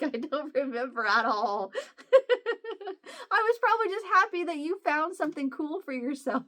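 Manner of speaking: talking through real laughter, pitch climbing; laughing. Real, not acted. Admitting to Emma she forgot, laughing. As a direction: laugh helplessly through the words. Laughs while retelling her forgetfulness.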